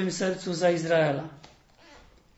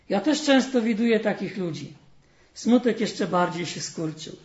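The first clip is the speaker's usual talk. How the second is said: lively storyteller voice, livelier than the sermon; fairy tale reading; 2 semitones higher, 4 dB louder than usual. Acted. Preacher reading a fairy tale to the congregation, storytelling. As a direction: read the tale warmly to the congregation.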